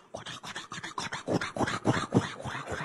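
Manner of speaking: soft, breathy chugging of train wheels; mock train clatter. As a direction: whisper the rhythmic clack of a train. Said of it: "火车的声音嘛". (轻声模仿火车咔嚓声)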